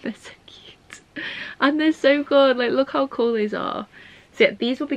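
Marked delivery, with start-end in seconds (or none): 0.0-1.0 whispered adoringly. Real, not acted. Vlogger admiring crochet pumpkins, whispering. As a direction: breathe it out in a whisper. Gushing over gift.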